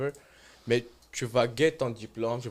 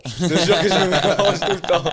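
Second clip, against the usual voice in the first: laughing admission; 7 semitones higher, 11 dB louder than usual. Real, not acted. Laughing admission of mixing accents.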